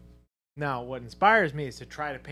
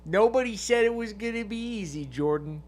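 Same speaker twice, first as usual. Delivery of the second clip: New Yorker; 9 semitones higher, 6 dB louder than usual. Pretend.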